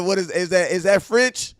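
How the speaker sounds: retelling a viewer's question about the French name; mock-eager fan. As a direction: imitate a curious fan pressing his question. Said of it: "He said".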